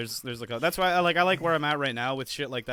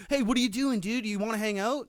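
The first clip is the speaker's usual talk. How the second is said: higher, breezy mock buddy voice; a higher, cheerful imitation of a friend calling; casual buddy invite; 8 semitones higher than usual. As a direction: play the flaky friend asking to hang out. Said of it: "they're like".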